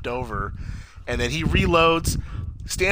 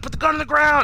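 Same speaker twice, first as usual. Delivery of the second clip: high, loud mock command; shouted order; 5 dB louder than usual. Imitating agents getting out to stop him, shouted command. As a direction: imitate a cop yelling an order.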